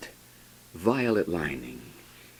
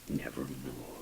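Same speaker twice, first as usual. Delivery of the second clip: much softer, low; hushed refrain; 8 semitones lower, 15 dB quieter than usual. Acted. Raven's final reply, soft and low. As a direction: drop to a low murmur.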